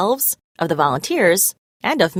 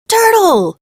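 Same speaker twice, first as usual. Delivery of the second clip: whoop; 13 semitones higher, 7 dB louder than usual. A narrator's reading. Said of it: "he whoops".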